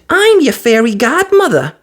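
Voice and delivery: very high, loud pleased fairy; fiery fairy. A narrator's reading. Fiery fairy choosing the dog Buttons, decisive. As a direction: snap it out decisively.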